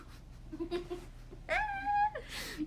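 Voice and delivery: squealing high laugh; real laugh at her own story. Real, not acted. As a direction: burst into a shrieking laugh.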